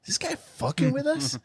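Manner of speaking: high disbelieving question; a high baffled aside; incredulous. Retold or imitated. Retold disbelief at seatmate.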